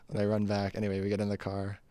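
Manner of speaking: usual speaking voice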